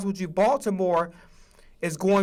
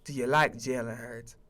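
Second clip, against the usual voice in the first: mock Jerry; 8 semitones lower, 7 dB quieter than usual. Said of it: "Jerry was like".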